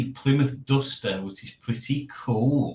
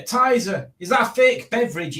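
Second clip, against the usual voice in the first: mock voice; 10 semitones higher, 3 dB louder than usual. Reading viewer comment in voice.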